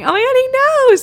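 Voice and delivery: high, loud excited squeal; hyped up. Retold or imitated. Client thrilled by the psychic's assurance, hyped-up squeal. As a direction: shoot way up in pitch, thrilled. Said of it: "I'm hyped up even more".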